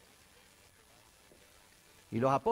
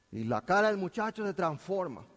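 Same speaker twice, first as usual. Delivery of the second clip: ordinary delivery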